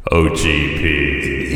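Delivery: digital echo. Real, not acted. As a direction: voice with a heavy echo effect. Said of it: "check out the echo effect".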